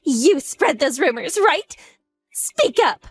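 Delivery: high, heated accusing voice; outraged confrontation; angry demand. Acted. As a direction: press her hard for an answer. Furious confrontation over rumors.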